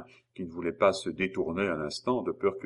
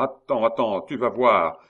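raised, menacing voice; shouted threat; 3 semitones higher, 6 dB louder than usual. A narrator's reading. Gaoler threatening the fleeing child, shouting. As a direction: threaten loudly, building to the end. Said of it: "cria la même voix".

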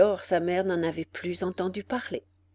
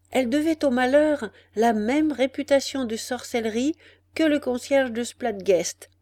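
usual speaking voice

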